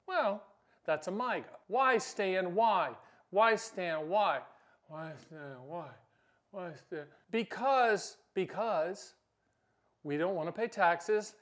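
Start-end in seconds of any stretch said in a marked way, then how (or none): 4.9-7.1 very soft echoed question; hushed, dying-away plea; fading echo. Acted. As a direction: repeat it almost in a whisper.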